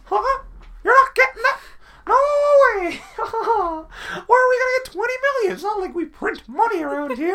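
greedy studio boss in a high squeal, laughing; mocking Disney. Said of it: "And Disney are like".

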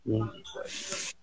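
hushed whisper of "Here's the question.". Shown as a demonstration. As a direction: breathe it as a secret to the chat.

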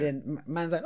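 ordinary talk

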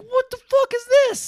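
loud, high-pitched incredulous yell; mock outrage. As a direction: shout it in comic disbelief. Outraged at the father leaving funeral.

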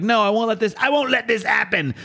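high-pitched, hammed-up Australian protest; Australian accent. Rock jumping after Time Trapper, protesting in an Australian accent. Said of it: "the past one is Australian to me".

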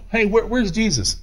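ordinary delivery

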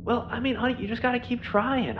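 high, muffled woman's voice; muffled female voice. Acted. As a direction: sound like a woman behind a stall door. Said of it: "much more muffled female voice just go".

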